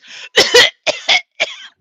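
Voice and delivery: loud, explosive hacking coughs; imitated cough. Showing store staff what the produce worker did, imitated loud coughing. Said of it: "doing this".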